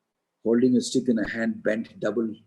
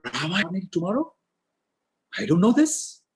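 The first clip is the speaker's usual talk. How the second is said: higher, fast, incredulous; shocked disbelief; 7 semitones higher, 3 dB louder, faster than usual. Acted. Manthara hears of Rama's coronation, high shocked disbelief. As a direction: hit the news like a blow, pitched up.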